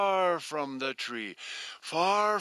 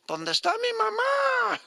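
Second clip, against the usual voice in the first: put-on baby bird voice; faster than usual. Baby bird calls for mother.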